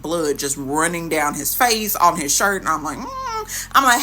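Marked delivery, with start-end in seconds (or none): 3.0-3.7 skeptical mm. Retold or imitated. Reacting to bleeding man.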